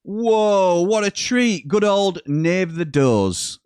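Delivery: high, loud, drawn-out gushing; hammed-up delight. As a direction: read it out with big, soaring enthusiasm. Reads out viewer's greeting with gusto.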